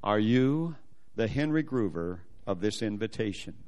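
low, measured mock-official question; stern questioning. Embassy lady checking his invitation, mildly stern and low. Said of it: "and very sternly says".

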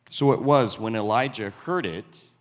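usual speaking voice

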